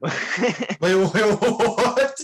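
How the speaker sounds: high, loud startled disbelief; surprised laugh. Real, not acted. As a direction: blurt it out in laughing shock. Shocked by parking story.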